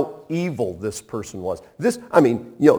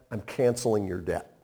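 tender pardon; 6 semitones lower, 9 dB quieter than usual. Acted. Repeated gentle pardon.

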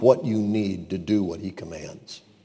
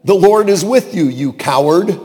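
mock insult; 5 semitones higher, 6 dB louder than usual. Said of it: "God could have said to him".

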